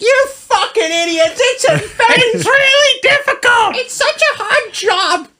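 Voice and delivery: very high, slow, hammed-up Jersey whine; Jersey accent. Imagined solar installers complain in the comments, high mock Jersey wail. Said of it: "why they have a Jersey accent".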